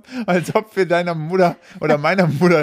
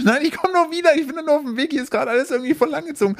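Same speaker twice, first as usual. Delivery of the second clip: much higher, fast and flustered; high-pitched panicky voice; 11 semitones higher, faster than usual. Retold or imitated. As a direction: squeak it out in a panic. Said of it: "Ich so".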